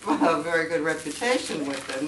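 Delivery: normal talking voice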